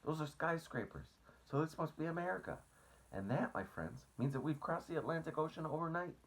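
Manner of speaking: bursting with the news; excited. A narrator's reading. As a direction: James jumping up and down, high and eager. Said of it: "jumping up and down with excitement".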